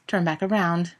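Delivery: ordinary delivery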